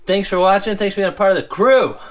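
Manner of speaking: bright, loud, high outro; loud happy thanks to the crew; excited sign-off. Real, not acted. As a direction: cheer the viewers goodbye.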